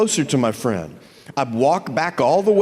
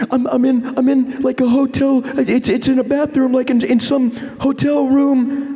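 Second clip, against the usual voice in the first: high, louder mock panic; high confused panic; scared friend; 11 semitones higher, 3 dB louder than usual. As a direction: babble it in a fright. Friend voiced panicking on phone.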